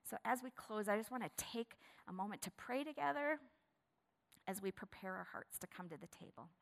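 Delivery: soft, hushed pastoral voice; quiet closing prayer invitation; prayer lead-in. Real, not acted. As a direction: invite them gently toward prayer. Leads into prayer.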